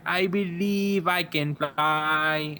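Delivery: slow, high mock Chinese accent; high-pitched Chinese accent. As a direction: draw it out slowly in a put-on Chinese accent. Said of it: "in a really high-pitched Chinese accent".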